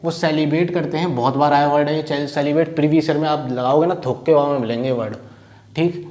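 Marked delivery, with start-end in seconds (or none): none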